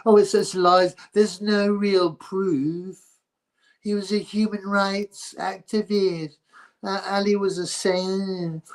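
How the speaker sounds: high, slow sarcastic whine; mocking normie voice. Mocking normies who excuse Ali, high, slow, sarcastic. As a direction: parody the fans' excuses. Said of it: "That's how normies justify".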